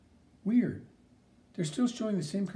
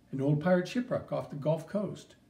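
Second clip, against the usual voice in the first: usual speaking voice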